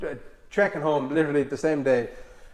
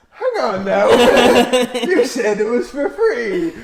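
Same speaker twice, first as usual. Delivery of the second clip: higher, louder, slow amused objection; laughing protest; 9 semitones higher, 8 dB louder, much slower than usual. Retold or imitated. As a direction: call out the broken promise, laughing. Catching the scam.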